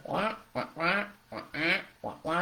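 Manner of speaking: muffled mock trombone wah-wah, lightly done; Charlie Brown teacher wah. Mom mimics what kids hear from a nagging parent, mock wah-wah. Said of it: "here's what they hear".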